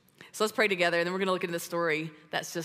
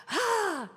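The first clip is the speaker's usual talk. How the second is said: thrilled angel sigh; 14 semitones higher than usual. Retold or imitated.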